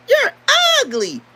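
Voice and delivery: high-pitched mocking call; say it just like that. Shown as a demonstration. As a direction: squeal it high, then let it drop. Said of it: "When you say it like that".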